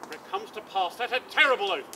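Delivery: normal talking voice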